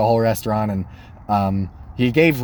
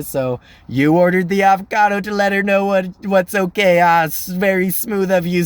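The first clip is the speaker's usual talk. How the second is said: higher-pitched, knowing mock praise; teasing waiter; 5 semitones higher than usual. Waiter ribbing a diner about his avocado move, teasing. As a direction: lightly voice a waiter teasing a customer.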